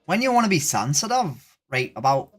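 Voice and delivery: put-on Irish accent greeting; chirpy fake Irish brogue. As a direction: say hello like a cheery Irish local. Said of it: "Irish accent for the rest of the stream".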